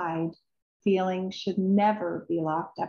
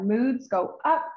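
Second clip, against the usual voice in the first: ordinary delivery, nothing marked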